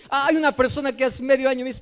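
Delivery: ordinary talk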